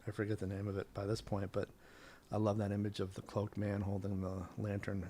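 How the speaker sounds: ordinary talk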